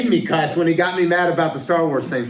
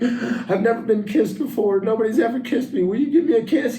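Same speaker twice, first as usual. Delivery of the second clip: higher, pleading mock-weepy woman voice; whiny crying lady; 6 semitones higher than usual. Joke teller voicing the sad lady, mock weepy. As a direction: beg for a kiss in a high whimper.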